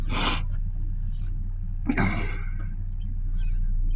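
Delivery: long unvoiced sigh out through the mouth; heavy sigh. Real, not acted. Facing the camera, a heavy tired sigh. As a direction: let out a weary breath. Another sigh, thinking it over.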